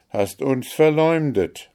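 moderately heated denunciation; angry accusation. A narrator's reading. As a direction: accuse with a queen's offended heat. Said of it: "der Zorn rötete ihre Wangen".

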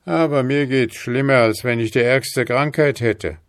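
leicht gehobene, lautere, verzagte Männerstimme; betrübter Mann. A narrator's reading. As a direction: abwinkend und mutlos. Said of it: "entgegnete er". (ein Hauch von Verzweiflung)

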